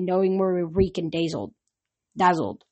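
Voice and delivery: ordinary delivery, nothing marked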